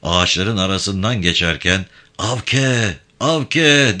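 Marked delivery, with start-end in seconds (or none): 2.2-4.0 mildly raised, higher second call. A narrator's reading. Yavan calling for Avke through the trees, calling out. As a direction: call the name twice, building louder. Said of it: "diye bağırmasını sürdürdü".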